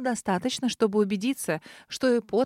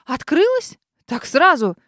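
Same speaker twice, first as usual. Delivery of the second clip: higher, louder stunned cry; shocked exclamation; 5 semitones higher, 8 dB louder, slower than usual. A narrator's reading. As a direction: blurt it out in disbelief. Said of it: "потрясённо воскликнула".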